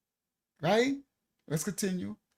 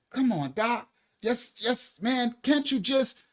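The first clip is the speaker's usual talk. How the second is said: higher coaxing plea; pleading; 6 semitones higher, slower than usual. Retold or imitated. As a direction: wheedle the doctor. Pleading with doctor.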